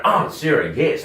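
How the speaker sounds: high, mock-swooning approval; hammed-up delight at her touch; pleasured encouragement. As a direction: playfully urge her on. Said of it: "it was that back and forth".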